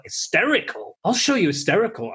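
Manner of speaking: high, indignant mock outrage; outraged retort. Woman retorting to the dismissive townsman, high and indignant.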